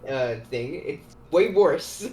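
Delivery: ordinary delivery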